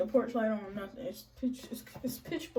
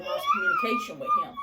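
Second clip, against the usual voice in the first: hammed-up crying wail, far higher and faster; mock wailing; 5 dB louder, faster than usual. Pregnant wife lost contact with husband, hammed-up wail. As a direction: fake a dramatic sob through it.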